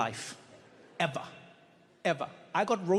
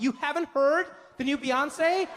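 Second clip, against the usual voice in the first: mock disbelief; 8 semitones higher, slower than usual. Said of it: "they'll be like".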